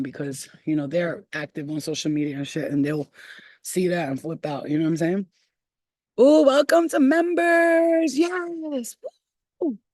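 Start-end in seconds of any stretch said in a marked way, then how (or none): 6.2-9.8 much higher, louder, slow; excited welcome. Real, not acted. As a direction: greet the new member with joy. Greets new members.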